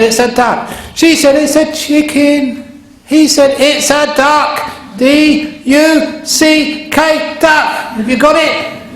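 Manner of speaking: high, loud, drawn-out mock shouting match; escalating quarrel. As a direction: bicker at a yell, stretching each word.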